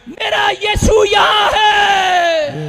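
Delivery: loud, high revival preacher shout; rousing faith proclamation, building to a long held finish; full-force preaching. Real, not acted. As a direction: drive each declaration home to the crowd.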